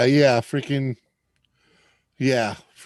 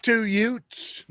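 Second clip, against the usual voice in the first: higher-pitched New York wiseguy; Joe Pesci impression; 7 semitones higher than usual. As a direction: quote it in a high movie-gangster voice. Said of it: "Joe Pesci?".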